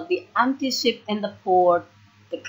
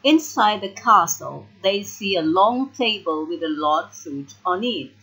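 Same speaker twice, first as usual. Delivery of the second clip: plain reading voice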